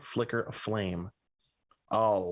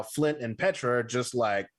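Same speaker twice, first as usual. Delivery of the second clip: normal talking voice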